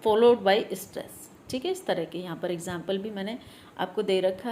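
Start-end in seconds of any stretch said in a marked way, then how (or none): 0.1-1.5 slow demonstration. Shown as a demonstration. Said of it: "यानी कि stress है तो stress".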